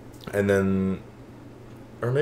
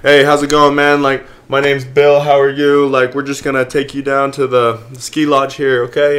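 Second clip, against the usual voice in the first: upbeat, louder friendly greeting; ski patrol guy; 5 semitones higher, 6 dB louder than usual. Retold or imitated. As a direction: play the cheery patrol guy. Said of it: "he's like".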